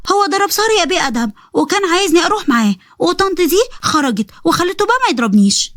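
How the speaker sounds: put-on high childish telling-on voice; little girl complaining. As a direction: tell on him like a little girl.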